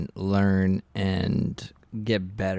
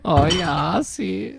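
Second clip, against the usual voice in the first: put-on German accent; 8 semitones higher, 5 dB louder than usual. Joins the German voice bit.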